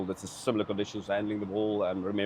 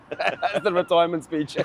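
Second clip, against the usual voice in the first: laughing; 6 semitones higher than usual. Real, not acted. Laughing punchline about wife.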